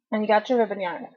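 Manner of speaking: talking around a cough drop; words slightly thick from a lozenge; mouth full. Real, not acted. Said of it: "excuse me if I have a cough drop in my mouth".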